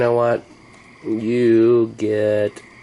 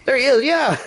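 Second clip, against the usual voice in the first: excited; 4 dB louder than usual. Real, not acted. Bug reappears.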